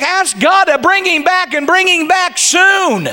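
high, shouted preaching; fervent exhortation. Real, not acted. Preacher urging congregation to ask God, fervent exhortation.

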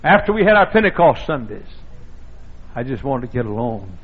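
ordinary talk, nothing marked

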